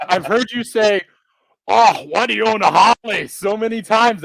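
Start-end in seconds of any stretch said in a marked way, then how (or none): 1.7-3.3 put-on Scottish accent. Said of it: "I figured I'd be able to nail it".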